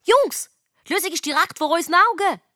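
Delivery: high, excited exclamation; calling out. A narrator's reading. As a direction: call out a sudden realisation. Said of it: "und ruft".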